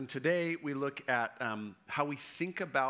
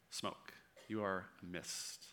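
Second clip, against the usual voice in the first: much softer, lower, letting each word fade; hushed sermon close; 6 semitones lower, 10 dB quieter than usual. Real, not acted. Preacher leaving the congregation with James's image, hushed. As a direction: drop to a near hush like vanishing smoke.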